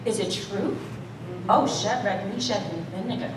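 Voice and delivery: coy king. Retold or imitated. King teasing Shadrach, Meshach and Abednego, softly coy. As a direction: play it cute and sly. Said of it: "he was trying to be cute about it".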